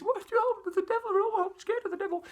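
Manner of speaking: put-on very high, wailing frightened girl; a frightened girl sobbing about the devil, very high; scared crying girl. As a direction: squeal it like a terrified little girl. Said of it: "She's crying her eyes out".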